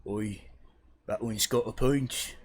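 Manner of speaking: lightly voiced street thug; second thug. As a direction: play a thug mildly, in character. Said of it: "hasn't spoken yet kind of looks at you and goes".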